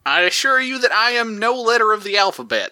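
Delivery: a weak cowboy accent played with dignity; bad cowboy accent. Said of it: "He'd be way better at this cowboy accent".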